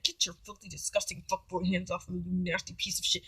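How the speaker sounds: low-volume venting, imitating a private thought; muted inner disgust. Woman silently cursing hugging date, muted mock disgust. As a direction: keep the contempt under the voice, softly.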